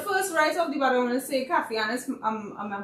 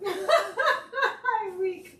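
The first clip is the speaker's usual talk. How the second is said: high laughing I'm weak; 12 semitones higher, 3 dB louder than usual. Real, not acted. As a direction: laugh through it. Laughing hard at joke.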